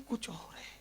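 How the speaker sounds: hushed tender whisper; whispered sermon line after a wail. Acted. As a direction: drop to a breathless whisper. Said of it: "whispered after the cry".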